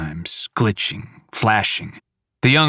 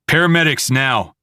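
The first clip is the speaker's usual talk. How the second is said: urgent order; 5 semitones higher, 4 dB louder than usual. Acted.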